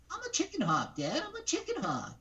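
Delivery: tiny hawk insisting proudly; Chicken Hawk. Retold or imitated. Guest doing the little Chicken Hawk, high and insistent. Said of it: "let me do the Chicken Hawk".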